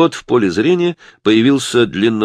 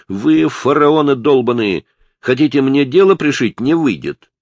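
loud, higher indignant outburst; heated, raised-voice protest; angry defiance; 7 semitones higher, 6 dB louder than usual. Acted. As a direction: throw the accusation back at the police. Cornered suspect defies the police.

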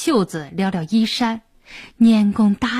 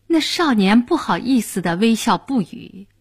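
ordinary delivery, nothing marked

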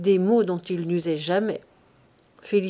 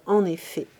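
ordinary delivery

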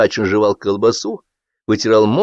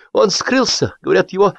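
high, strained tattling; crushed, squeezed-out excuse; 11 semitones higher than usual. A narrator's reading. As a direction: force out the rumour in a defeated voice. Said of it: "выдавил из себя прибитый Кытин".

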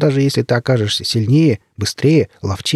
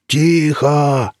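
drawn-out shout for silence; long, contained yell; bellowed command; 3 semitones higher than usual. A narrator's reading. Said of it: "заорал".

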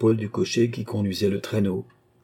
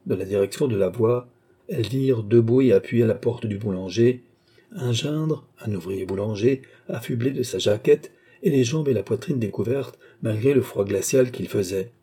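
ordinary delivery, nothing marked

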